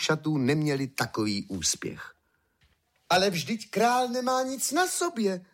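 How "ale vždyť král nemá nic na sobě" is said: put-on high-pitched child's exclamation; child crying out. A narrator's reading. Small child exclaiming at the naked king, high cry. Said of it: "zvolalo jedno malé dítě".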